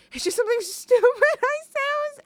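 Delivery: high and loud, breaking into a laugh; laughing at herself. Retold or imitated. Retelling to listeners how she brushed off her joke, laughing. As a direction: giggle through the excuse.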